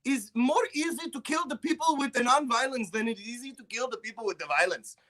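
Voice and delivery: high, hammed-up Serbian accent; comic Serbian accent thanking a friend on a podcast. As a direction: play the grateful Serbian guy in a thick put-on accent. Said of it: "I'm the, like, the Serbian guy".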